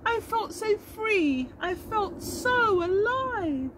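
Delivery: high, weepy little bee voice; a worn-out bee whimpering her story; tired bumblebee. Acted. The bee recalls her day.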